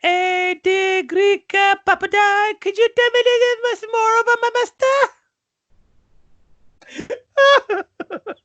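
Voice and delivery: very high, loud alien character voice; Bib Fortuna. Acted. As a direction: squawk it high in accented broken English. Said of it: "can you imitate Bib Fortuna".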